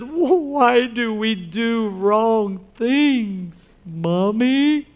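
slow, lightly voiced small child asking; a hint of a teary five-year-old; little girl voice. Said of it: "the little five-year-old girl said".

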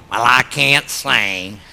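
low, slow put-on voice; mock excuse. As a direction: drag it out like a reluctant churchgoer. Mimics congregation's excuse.

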